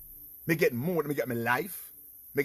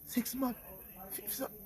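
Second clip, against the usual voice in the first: small, soft high voice echoing the sentence; hushed disbelief; 9 semitones higher, 10 dB quieter than usual. Retold or imitated. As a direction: repeat it faintly, higher and softer.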